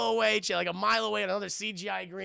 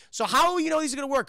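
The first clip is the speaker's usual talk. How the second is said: higher, fast corrected quote; mock exact quote; 6 semitones higher, 6 dB louder, faster than usual. Streamer correcting the doctor's actual line, quicker and higher. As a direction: rattle it off as the real line. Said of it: "She actually says".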